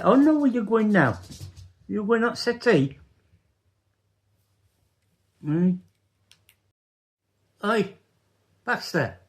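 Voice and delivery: high sing-song pet voice; talking to the dog. Real, not acted.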